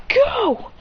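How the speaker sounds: high, short send-in cry; battle command. Acted. Trainer commands attack in battle.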